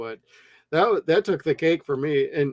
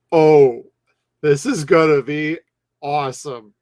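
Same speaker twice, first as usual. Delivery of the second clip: excited; 7 dB louder than usual. Retold or imitated. Audience gets excited.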